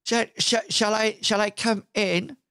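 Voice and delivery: high, stammering visitor voice; Percy. Acted. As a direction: ask timidly, tripping on the first words. GM voices timid visitor at door.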